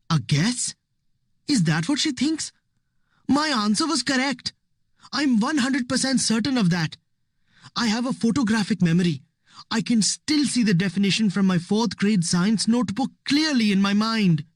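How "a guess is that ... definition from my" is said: higher, offended inner protest; indignant. A narrator's reading. Ravi silently protests the teacher's doubt, indignant. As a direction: bristle at being doubted.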